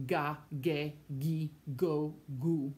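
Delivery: ga, ge, gi, go, gu all with hard G; hard G series. Shown as a demonstration. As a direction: list ga, ge, gi, go, gu with G as in go. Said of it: "we have to add an H to make".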